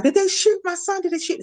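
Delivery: high, frightened pleading question; terrified dad fearing the worst; husband fearing his son was shot, high and panicked; panicked father. Retold or imitated. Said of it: "And he was like".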